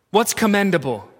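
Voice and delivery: usual speaking voice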